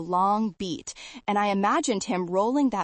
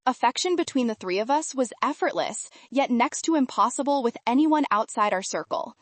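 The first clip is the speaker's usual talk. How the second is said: plain reading voice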